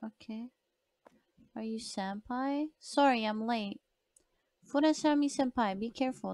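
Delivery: ordinary talk, nothing marked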